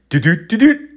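high, loud, quick heartbeat noise; racing heartbeat. Retold or imitated. Comic imitating his heart speeding up, high racing thumps. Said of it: "my heart trying to speed up".

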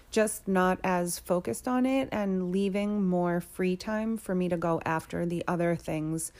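usual speaking voice

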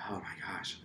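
hushed, breathy oh my gosh under her breath; whispered aside. Retold or imitated. Panicked aside to herself.

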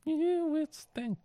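"Year with Sting" drawn out very slowly and high; high slow echo. Retold or imitated. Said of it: "echoing in a high drawl".

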